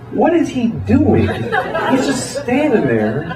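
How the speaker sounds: baffled outrage, pitch jumping up; incredulous. Acted. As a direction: question it in drawn-out disbelief. Baffled rant at man with sign.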